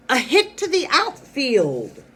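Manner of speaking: high, loud, drawn-out shout of excitement; excited stress. Shown as a demonstration. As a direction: call it like a thrilling big hit. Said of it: "read them with excitement".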